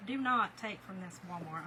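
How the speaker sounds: ordinary talk, nothing marked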